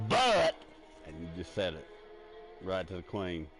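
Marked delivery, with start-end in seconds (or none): 0.1-0.5 high, loud "But," blurted out. Pretend. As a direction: pop "But," high and louder like a prank. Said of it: "And then you just go".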